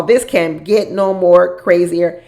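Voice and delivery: each word drawn out slowly; slow it right down and lean on it; slow emphatic repeat. Real, not acted. Vlogger repeating her reaction to the show, slowly.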